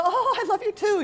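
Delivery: high, gushing affection; melting, high-pitched reply; gushing parent. Acted. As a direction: coo it like a won-over parent. Acted parent melting at child's words.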